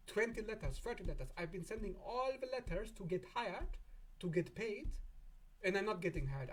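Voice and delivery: high, whiny complaint; frustrated client. Pretend. Frustrated client complaining to Saturn, high and whiny. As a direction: whine it up high, exasperated. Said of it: "You're like".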